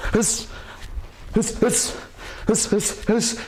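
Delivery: sharp hissed exhales, one per punch; punchy "hiss" bursts with gaps between; strike breathing. Shown as a demonstration. Coach throwing punches to show viewers, hissed strike breaths. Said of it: "We breathe on our strikes".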